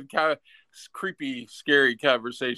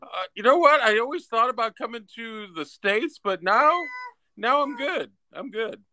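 higher, relieved Brit voice; imitating the Brits; 8 semitones higher than usual. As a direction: play a Brit who's glad to stay home. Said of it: "They're like".

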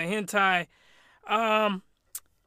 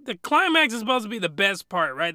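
ordinary talk

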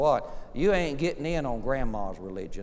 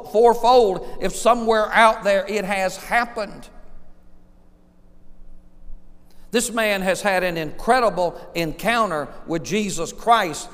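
louder, higher, pressing each claim; preaching at full force; 6 semitones higher, 6 dB louder than usual. Acted. Preacher insists to congregation Zacchaeus is no cheat, loud and rising. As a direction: hammer the point home like a revival preacher. Preacher drives home point.

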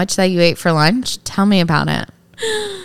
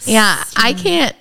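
ordinary talk

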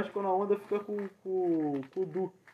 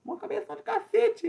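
mock taunt; 13 semitones higher than usual. Playful insult performance.

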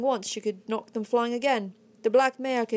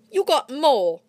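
loud, higher accusing snap; 5 semitones higher, 7 dB louder, slower than usual. A narrator's reading. Miner accusing Redhead of taking more money. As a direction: jab the blame at him. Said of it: "one of his friends accused him".

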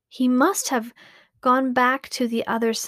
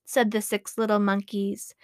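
ordinary delivery

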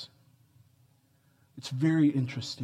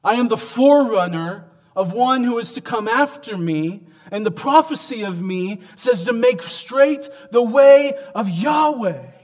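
higher and much louder, John's bold claim; fervent preaching; 8 semitones higher, 9 dB louder than usual. Acted. Preacher voicing John the Baptist's claim, loud and fervent. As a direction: preach it out with full force.

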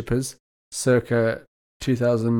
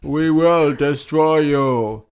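slow, louder menacing alien villain; drawn-out robotic threat from the Mysterons; 4 dB louder, much slower than usual. Retold or imitated. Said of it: "the Mist ones when they used to be like".

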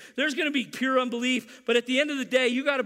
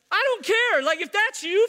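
playful outburst; 8 semitones higher, 5 dB louder than usual. Real, not acted. Preacher mock scolding.

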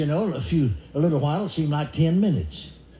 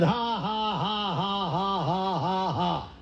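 forced fake laugh; 3 semitones higher, 4 dB quieter, much slower than usual. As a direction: put on a slow, evenly spaced fake laugh. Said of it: "I immediately started laughing again".

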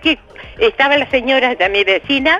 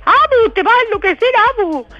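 granddaughter on the phone; 11 semitones higher than usual. Retold or imitated. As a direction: mimic the little girl scolding her grandma. (imitando a la nieta, aguda y más fuerte)

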